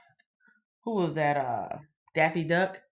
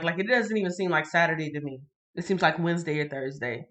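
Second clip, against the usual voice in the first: usual speaking voice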